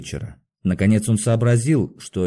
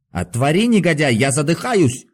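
higher-pitched, strained cry; hoarse shout; 5 semitones higher than usual. A narrator's reading. Patient cries through the door to be freed, hoarse. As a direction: shout as if short of air. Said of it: "крикнул он сиплым голосом".

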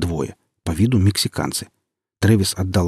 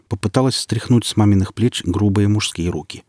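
ordinary delivery

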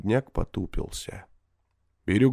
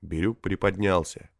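usual speaking voice

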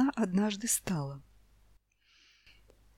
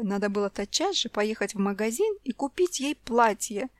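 ordinary delivery

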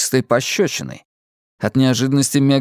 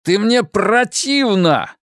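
slow, higher, spitting with disgust; husband tells wife she disgusts him, slow and disgusted; 8 semitones higher, slower than usual. A narrator's reading. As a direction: drag the words out in revulsion. Husband's angry outburst.

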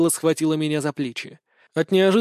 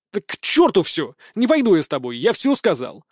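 ordinary delivery

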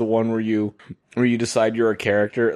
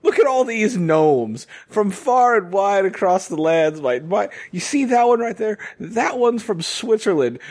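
proud gnome collector; 6 semitones higher than usual. Pretend. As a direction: lovingly present the gnome collection, voice up high. Proud gnome collector host role.

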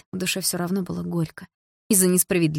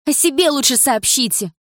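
higher, louder retort; snapping back; 8 semitones higher, 5 dB louder than usual. A narrator's reading. Дилара snaps back at the woman, higher and louder. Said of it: "огрызнулась Дилара".

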